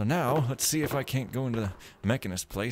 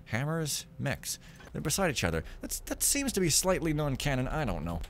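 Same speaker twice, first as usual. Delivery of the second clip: normal talking voice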